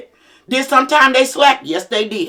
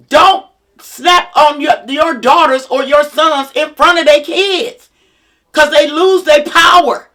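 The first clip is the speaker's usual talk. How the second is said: loud, slow, raised preaching to mothers; 4 semitones higher, 4 dB louder, slower than usual. Acted. Rallying mothers to take back their homes, loud and slow preaching. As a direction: drive it home like a sermon, slow on each word.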